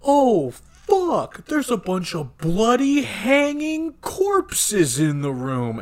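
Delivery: high, loud mock horror, stretched out; shocked discovery. Crew walking in on bloody hanging corpses, shocked. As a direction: act out a horrified find, drawing the words out.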